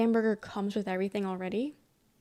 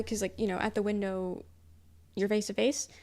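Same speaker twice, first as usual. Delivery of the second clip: usual speaking voice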